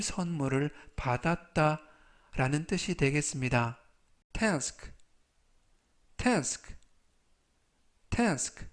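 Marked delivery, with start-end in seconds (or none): 4.3-8.7 "task" said with a flat "tesk" vowel; pronunciation model. Shown as a demonstration. Teacher models "task" for learners, clear and careful. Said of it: "라고 발음하시면 되겠고요".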